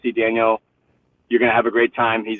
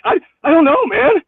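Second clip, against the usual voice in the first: high, panicky stammer; retold panic; 4 dB louder than usual. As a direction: relive the moment of panic at the altar. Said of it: "freaking out a little bit".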